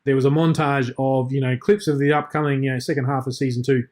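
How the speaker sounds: usual speaking voice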